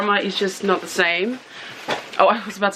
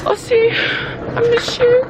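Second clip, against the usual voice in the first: mock wail; 13 semitones higher than usual. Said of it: "I'm gonna be saying it".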